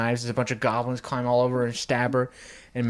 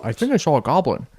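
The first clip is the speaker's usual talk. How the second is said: lightly voiced Grinch, higher and quick; a louder, hurried mock Grinch; 4 semitones higher, 6 dB louder, faster than usual. Grinch in a flashback reporting a goblin sighting, lightly impersonated. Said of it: "The Grinch is saying".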